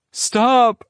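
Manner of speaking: high, loud desperate cry. A narrator's reading. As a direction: shout to save him. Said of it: "Angelina cries".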